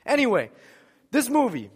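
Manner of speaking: normal talking voice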